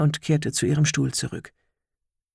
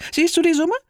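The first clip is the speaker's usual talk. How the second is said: put-on old woman voice; 5 dB louder than usual.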